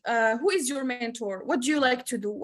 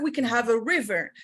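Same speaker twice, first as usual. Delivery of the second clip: usual speaking voice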